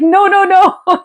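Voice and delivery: high, drawn-out imitation of alarm; hurriedly backtrack in a high, stretched voice; mock panic. Airline agent to crying caller, mock panicked. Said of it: "I could hear the panic in his".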